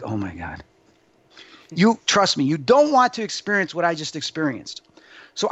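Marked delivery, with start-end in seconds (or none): 0.0-0.6 muttered low and soft, barely voiced; under the breath. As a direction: drop it low and soft to yourself. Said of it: "I'm like".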